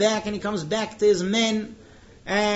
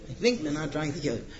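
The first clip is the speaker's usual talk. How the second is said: hedged aside; 4 semitones lower, 6 dB quieter, faster than usual. Shown as a demonstration.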